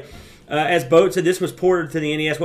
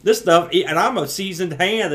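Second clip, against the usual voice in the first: ordinary delivery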